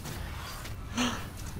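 long unvoiced high gasp of shock; sharp gasp. Real, not acted. Opening the ranged weapon crate, a whispered shocked gasp. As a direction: suck in air at the reveal.

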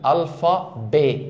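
"الفا ب" slow, as al-fa-bé; French pronunciation. Shown as a demonstration. Teacher builds the word for class, slow French pronunciation. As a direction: sound out alphabet in French, silent t. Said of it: "تتنطق كده".

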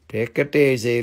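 low-pitched put-on voice; firmer self-calming. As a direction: say it low but at full volume. Repeats it in accented voice.

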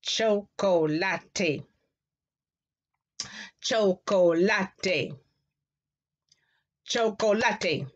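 Chocolate stretched very slowly, syllable by syllable, cho-co-la-te; very slow Spanish Chocolate; slow model Chocolate. Shown as a demonstration. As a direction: drawl out each syllable of Chocolate for the class. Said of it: "can you say that for me".

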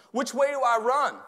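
high, loud huddle question; player calling out. Acted. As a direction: call it out to the quarterback. Voices football player calling in huddle.

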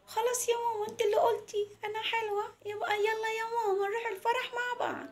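put-on very high, eager child voice; little girl Mona. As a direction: urge mum along as a small girl. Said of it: "قالت لها". (صوت طفلة رفيع ومستعجل)

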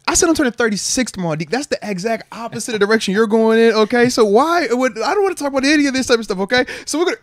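pumped, high and loud, steering away from death talk; excited, getting loud. Real, not acted. Host turning 36 hypes himself up, high and loud. Birthday tomorrow, refuses dark topic.

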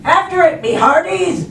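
high, loud order; commanding pirate. Acted. Braidbeard sends crew after the ball, loud command. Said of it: "Braidbeard commanded".

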